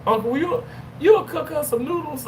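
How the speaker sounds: put-on high, pleading kid voice through a laugh; lightly voiced kids asking sweetly. Kids begging uncle for noodles.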